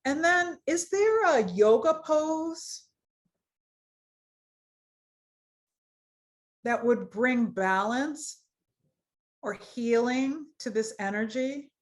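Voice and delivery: measured phrases with long pauses; guided meditation. Acted. Yoga teacher leads students through root chakra, guided meditation voice. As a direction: guide awareness through the body, leaving space to feel.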